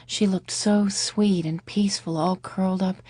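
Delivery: ordinary delivery, nothing marked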